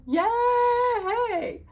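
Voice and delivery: high, loud, drawn-out yay; excited cheer. Real, not acted. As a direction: cheer it out with glee. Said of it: "I'm excited to be back".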